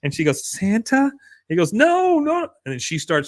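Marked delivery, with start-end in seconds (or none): 0.5-1.1 high, questioning imitation of a child; pitched-up childlike question; little girl. Acted little girl asking. 1.7-2.5 very high mock-desperate plea; pitched-up put-on panic; urgent protest. Acted stranded man protesting.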